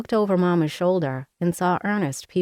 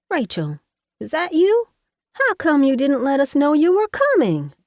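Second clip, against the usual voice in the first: high, loud country mother calling out; hollering Mama; 10 semitones higher, 5 dB louder than usual. Acted. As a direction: call out to your daughter from the doorway, surprised. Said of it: "she hollered".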